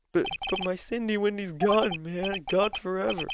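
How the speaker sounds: high, whining lament; hammed-up lovesick grief; melodramatic heartbreak. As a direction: wail over a lost sweetheart. Acts Larry grieving his girlfriend.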